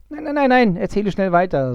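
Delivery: high, loud impatient cut-off. Acted. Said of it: "so der Abgucker ungeduldig".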